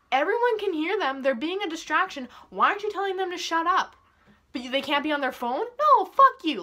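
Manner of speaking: loud, high-pitched indignant rant; exasperated, voice pushed up high; venting. Real, not acted. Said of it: "this is me just venting".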